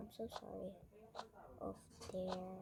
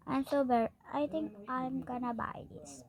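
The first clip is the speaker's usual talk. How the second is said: usual speaking voice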